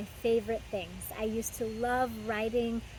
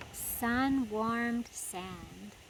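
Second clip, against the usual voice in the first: "Sun-warmed sand" drawn out very slowly, softer, rising; savoured repeat; 3 dB quieter, much slower than usual. Shown as a demonstration. Host repeating the turtle's phrase for kids, very slow and savouring. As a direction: linger on every word of "Sun-warmed sand". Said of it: "repeats line savouring".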